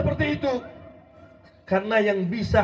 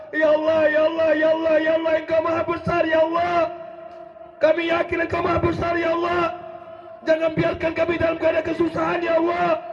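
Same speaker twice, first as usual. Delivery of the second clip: higher, pleading, half-shouted call to God; fervent crying-out prayer; 5 semitones higher than usual. Retold or imitated. Preacher acts out a desperate worshipper, higher and half-shouting. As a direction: beg God with everything you have. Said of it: "Kita nangis dan nangis dan berteriak".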